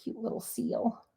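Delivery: a soft whisper to herself about the die piece; whispered aside. Real, not acted. Crafter whispering fondly over a cute die piece. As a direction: hush it, just admiring the tiny shape.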